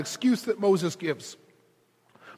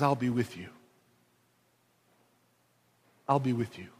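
much softer and lower; gently, almost hushed; God comforting Moses; tender reassurance; 10 semitones lower, 9 dB quieter, faster than usual. Acted.

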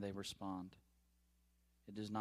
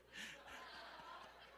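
airless wheezing laugh; real laugh, almost no voice; breathless silent laugh; 15 dB quieter than usual. Real, not acted. Preacher cracking up at his own story, breathless wheeze.

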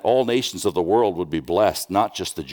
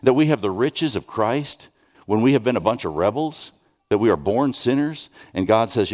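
normal talking voice

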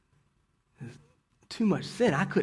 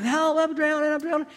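put-on much higher, shouted child's cry; panicked cry for help; 13 semitones higher than usual. Pastor voicing a drowning boy, high panicked shout. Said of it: "and he's crying".

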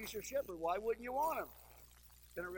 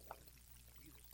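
whispered aside, softer; half-whispered husband's confession; husband whispering; 21 dB quieter than usual. Retold or imitated. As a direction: whisper it so the wife can't hear. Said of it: "The husband's like".

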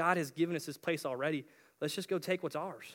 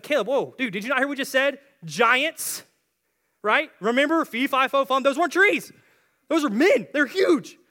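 loud, high, panicky objection; alarmed protest; 9 semitones higher, 12 dB louder than usual. Acted. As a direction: protest loudly, freaked out by the giants. Alarmed spies argue back about giants.

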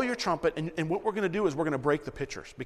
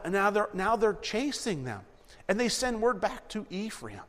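usual speaking voice

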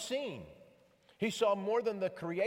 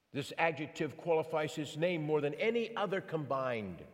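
ordinary delivery

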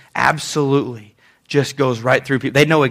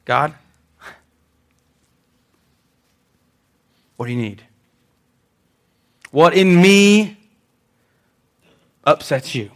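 quiet prayer. Shown as a demonstration. Preacher models a humble prayer to God, hushed. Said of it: "That's a hard prayer to pray".

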